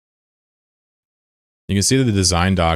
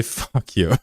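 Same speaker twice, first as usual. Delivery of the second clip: laughing; 8 semitones higher than usual. Real, not acted. Laughing at rival.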